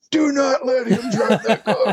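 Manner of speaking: slow, high, urgent plea; mock alarmed warning. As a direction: imitate a panicked order, word by word. Guard warns tour manager from car.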